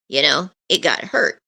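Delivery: ordinary talk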